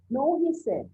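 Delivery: ordinary talk, nothing marked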